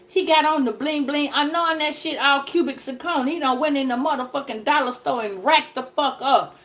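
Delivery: ordinary talk, nothing marked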